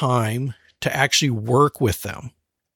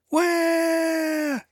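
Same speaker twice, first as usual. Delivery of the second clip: high, drawn-out mock wail; imitated child's wail; 14 semitones higher, 4 dB quieter than usual. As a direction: imitate a small child bawling behind a door. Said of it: "I close the door, and I hear".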